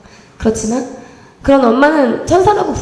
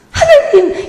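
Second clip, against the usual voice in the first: exasperated cry to God; 6 dB louder, much slower than usual. Retold or imitated. Despair at same school result.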